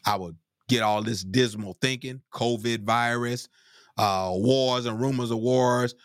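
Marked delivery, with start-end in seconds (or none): none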